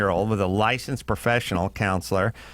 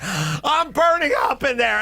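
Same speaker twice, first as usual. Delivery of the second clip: heated family shouting; 9 semitones higher than usual. Retold or imitated. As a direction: yell it out high. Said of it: "It's like".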